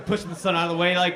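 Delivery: normal talking voice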